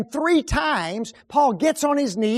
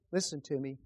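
usual speaking voice

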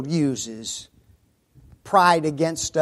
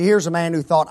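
usual speaking voice

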